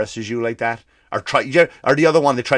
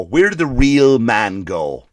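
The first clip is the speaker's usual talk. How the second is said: ordinary talk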